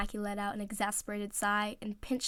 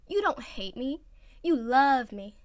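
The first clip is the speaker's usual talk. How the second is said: louder, pouting sing-song; pouty insistence; 4 semitones higher, 6 dB louder, slower than usual. Acted. Oikawa to Iwazumi, pouting and wheedling.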